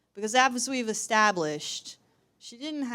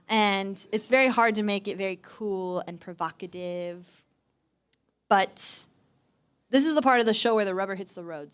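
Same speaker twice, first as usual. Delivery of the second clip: normal talking voice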